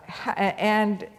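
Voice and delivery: ordinary delivery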